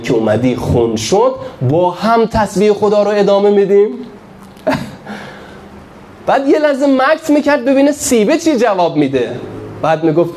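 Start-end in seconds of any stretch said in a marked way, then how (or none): none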